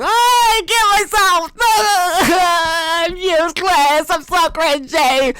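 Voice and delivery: high, whiny self-pity with a laugh; mock despair. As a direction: wail it out in hammed-up shame. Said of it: "I'll be like".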